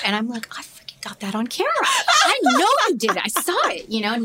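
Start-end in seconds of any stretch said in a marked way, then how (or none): 0.6-1.7 fast hushed whisper. Retold or imitated. Mother confronting lying son. 2.2-3.9 high, loud accusing shout. Retold or imitated. Mother confronting lying son.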